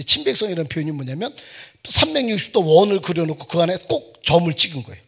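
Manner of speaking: usual speaking voice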